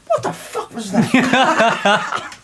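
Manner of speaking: drawn-out shocked cry, high and loud; slow startled yelp. Acted. The actor startled on stage by the cannon roar, slow and loud. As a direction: react in real shock to the gunfire. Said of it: "the actor goes".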